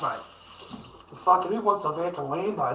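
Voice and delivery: ordinary talk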